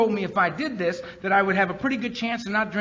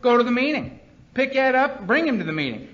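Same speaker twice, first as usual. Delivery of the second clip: loud, higher barked commands; blunt orders; 7 semitones higher, 4 dB louder than usual. Retold or imitated. Sponsor ordering Earl to pick Ed up, loud and blunt. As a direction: order him to the meeting, no discussion. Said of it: "He just said".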